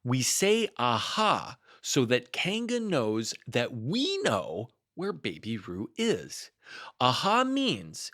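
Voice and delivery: slow, deliberate explanation; patient lecturing Rabbit; slow explaining. Acted. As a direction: spell it out slowly for Pooh.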